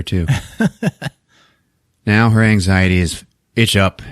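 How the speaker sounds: normal talking voice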